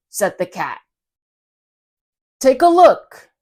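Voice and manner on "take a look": high, loud showman call; cat showing off his trick box, loud and high. Acted. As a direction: present it like a magician's reveal.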